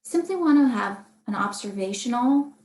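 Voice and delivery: ordinary talk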